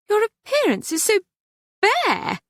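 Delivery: put-on high French-accented sniffing disdain; haughty French mother. Mother sniffing at the daughter's plain ring, haughty high French accent.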